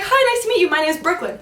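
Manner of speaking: lightly voiced chirpy kid; bright chirpy girl greeting. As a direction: mimic a cheerful kid introducing herself. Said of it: "she's like".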